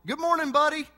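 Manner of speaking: high, loud cheery good morning buddy; yelling back. Retold or imitated. Preacher calling back to an unseen stranger, loud and high. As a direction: shout it back blindly. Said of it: "so I just blindly yell".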